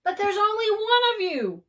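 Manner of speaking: high, loud and fast exasperated shout. A narrator's reading. Bird shouting at Sheep in exasperation. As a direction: yell it in disbelief. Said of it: "shouted Bird".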